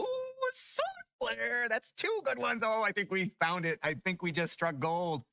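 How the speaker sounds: high, drawn-out squeal easing down; thrilled squeal. Real, not acted. Player gasps at a rare solar flare drop, thrilled squeal. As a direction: squeal with delight, stretching it out.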